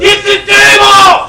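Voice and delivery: loud slow high shout; shouted catchphrase. Retold or imitated. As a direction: yell it like a wrestling promo.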